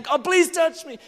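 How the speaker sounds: high, loud mock-desperate plea; pleading prayer. Preacher re-enacts begging God to touch him, pleading. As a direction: beg loudly, pitch shooting up.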